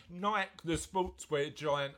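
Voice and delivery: ordinary delivery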